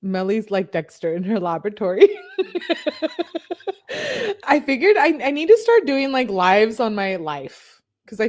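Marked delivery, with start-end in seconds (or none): 2.1-4.4 high burst of real laughter. Real, not acted. Laughing at her own joke.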